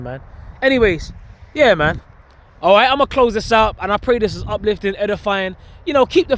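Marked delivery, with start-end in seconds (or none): none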